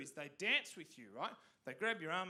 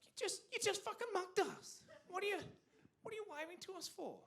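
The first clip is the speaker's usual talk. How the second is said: much higher indignant cry; outraged disbelief; 12 semitones higher than usual. Retold or imitated. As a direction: protest being mugged then waved at. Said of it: "It's like".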